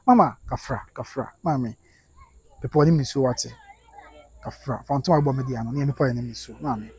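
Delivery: soft, low soothing voice; tender consoling. Real, not acted. Host soothes a viewer who can't stop crying, tender. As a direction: hush someone who is crying, gently.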